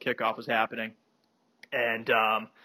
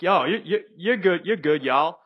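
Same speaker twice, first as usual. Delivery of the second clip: higher-pitched imitated southern voice; Bo's southern accent; 6 semitones higher than usual. Bo welcoming a rival BC fan, southern accent. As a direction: imitate a friendly Southerner, voice lifted. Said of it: "in his southern accent".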